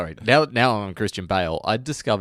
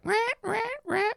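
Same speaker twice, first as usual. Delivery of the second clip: high, squeaky repeated "rah" noises; mock psycho stabbing screeches; 4 dB quieter than usual. Retelling an actor's knife joke, mock psycho screeches. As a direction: screech out mock slasher stabs. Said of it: "some sort of mock psycho".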